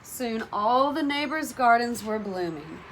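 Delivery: ordinary delivery, nothing marked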